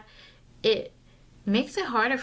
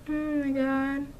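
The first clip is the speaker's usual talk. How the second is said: higher dismayed complaint; dismayed groan; 5 semitones higher than usual. Real, not acted. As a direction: cringe at the result. Dismayed at eyebrows.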